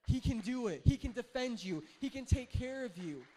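loud, fervent preaching; preaching force. Real, not acted. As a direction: proclaim it with full force. Pastor preaching, rising force.

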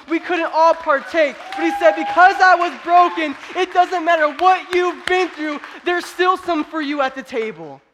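very high, shouted sermon climax; preaching at full force. Real, not acted. Preacher proclaiming there's still room at the table, full-force preaching. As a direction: build to a loud, drawn-out proclamation.

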